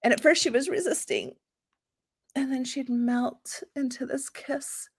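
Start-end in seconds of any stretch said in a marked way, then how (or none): 2.4-4.9 dreamy. Acted. Narrating the swoon dreamily.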